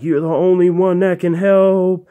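stretched, pleading last words of a dog; dying ghost dog plea. Retold or imitated. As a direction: drag it out like a fading ghost. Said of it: "as he was barking and dying".

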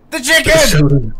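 loud, slow punchline; shouted punchline. Pretend. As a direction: bellow the punchline slowly.

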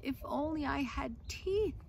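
lively storybook reading voice; reading to children. A narrator's reading. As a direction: read the tale aloud to a class. Reads picture book aloud.

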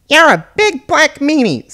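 high, loud, drawn-out insult; childish taunt. Acted. Cyrano tells off the rude engine, high loud taunt. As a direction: shout it in a high, slow child-like voice. Small character hurls an insult.

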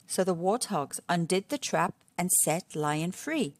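ordinary delivery

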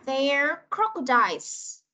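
very slow "There crocodiles", every sound clear; slow model sentence. Shown as a demonstration. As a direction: model it slowly for young learners. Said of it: "Ok, cô sẽ đọc này".

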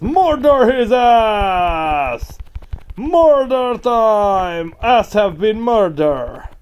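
loud, high, slow gleeful yelling; gleeful slow battle cry; excited yell. Real, not acted. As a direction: stretch out every word in glee. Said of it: "I got a little excited".

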